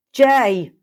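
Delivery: high, loud shout of a name; furious yell. Acted. Kesa yelling for Jay who ate her potion, furious shout. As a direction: bellow his name up the stairs.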